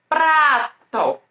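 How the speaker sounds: "Prato" with a stretched pra, pause, then to; sons separados. Shown as a demonstration. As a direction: model "Prato" slowly, syllable by syllable. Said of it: "Vamos lá para outra palavrinha". ("Prato" bem articulado, pra alongado e depois to)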